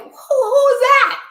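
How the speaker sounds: startled. Retold or imitated. Driver startled by a car whipping in around her. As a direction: react as a car cuts in front of you.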